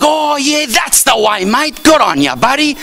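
high, hearty mock Aussie; broad Australian accent. Aussie congratulating actor on his film, mock Australian accent. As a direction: imitate an Australian's pat-on-the-back praise. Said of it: "compared to their accent".

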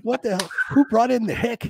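half-laughing mock shout; amused mock protest; laughing mock outrage. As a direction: laugh it out in fake indignation. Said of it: "It's like".

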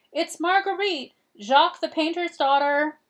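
calling out. A narrator's reading. Girl calling her name into a dark shed. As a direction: announce yourself to someone out of sight. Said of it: "she called into the shadows".

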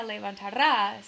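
levantará with strong stress on the last syllable -RÁ; acento final. Shown as a demonstration. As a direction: punch the final á of levantará as if it carries an accent mark. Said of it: "si hubiera un tilde escrito". (levantará con la última sílaba fuerte, como con tilde)

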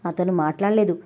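ordinary delivery

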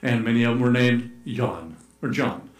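usual speaking voice

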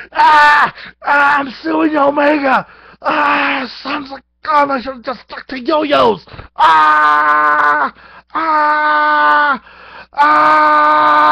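very high, very loud mock screams of pain; hammed-up pained yelling. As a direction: yell like you just whacked your own head. Whacked yourself, hammed agony.

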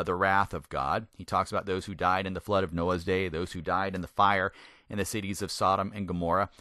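ordinary talk, nothing marked

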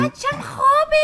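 high put-on neighbour woman voice; put-on woman's voice. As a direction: squeak it like the neighbour lady. (صدای زنانهٔ ساختگی)

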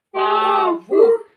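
loud, high, stretched syllables; loud demo. Shown as a demonstration. Teacher shows the child how to say it, loud and drawn out. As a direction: sound it out at full voice. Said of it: "А громко надо казати".

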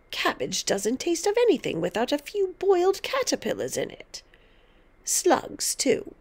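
gleefully lecturing grandmother; domineering grandmother, relishing. A narrator's reading. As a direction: savour each horrible item.

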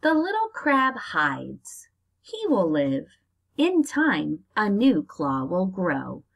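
slow, lively storybook reading; reading to children. Acted. Teacher reading a crab book to her class, storybook style. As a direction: read it out to little kids, drawing it out.

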